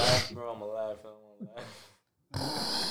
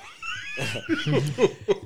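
high burst of laughter. Real, not acted. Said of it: "No, no, stop, stop".

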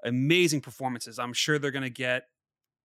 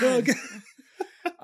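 laughing; 11 semitones higher, 4 dB louder than usual. Pretend. Echoing the joke, laughing.

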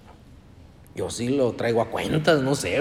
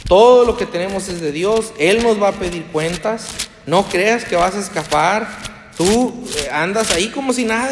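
loud, higher preaching; fervent warning; 6 semitones higher, 7 dB louder than usual. Acted. Pastor warning the congregation of judgment, loud. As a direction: warn them there is no escape.

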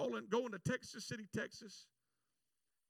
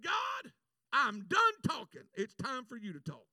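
fed-up yell; angry shout at God; 3 semitones higher, 9 dB louder than usual. Retold or imitated. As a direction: shout your ultimatum to heaven. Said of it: "I got so mad".

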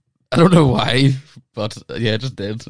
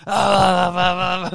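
laughing; 9 semitones higher, slower than usual. Real, not acted. Laughing at his own story.

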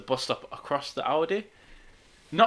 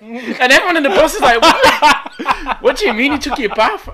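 laughing; 10 semitones higher, 10 dB louder than usual. Real, not acted. Bus crowd reacts.

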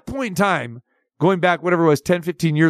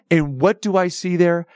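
ordinary delivery

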